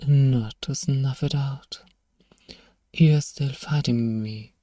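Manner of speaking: normal talking voice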